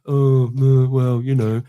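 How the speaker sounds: a sigh-laden mock moan; mock moaner. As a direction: imitate someone dreading everything. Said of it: "when people go like".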